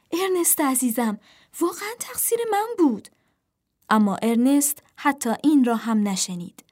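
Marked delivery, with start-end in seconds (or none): 0.1-3.1 high-pitched, hurried plea; placating. A narrator's reading. Sam tells angry Ernest it was their fault, pleading. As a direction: plead to draw the anger onto yourself. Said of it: "سام سعی کرد خشم و هیجان کلام ارنست را معطوف خود سازد".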